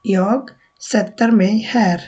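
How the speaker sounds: jag sätter mig spoken very slowly, word by word; slow careful model sentence for learners; slow Swedish demo. Shown as a demonstration. Teacher modelling a Swedish sentence for students, very slow. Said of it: "তো আমরা বলবো".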